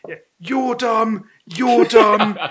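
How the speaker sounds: drawn-out imitation of a drunk insult; slurred drunk. Drunk bloke insulting Tom, mock slurring. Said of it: "slurring all his words".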